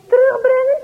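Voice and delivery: put-on shocked boy. Boys shocked at having to return apples. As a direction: gasp the word in disbelief.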